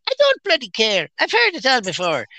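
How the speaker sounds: put-on a light Irish accent from mom. Irish mom dismisses potato scare, light Irish accent. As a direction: brush it off like an Irish mother. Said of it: "she had an Irish accent".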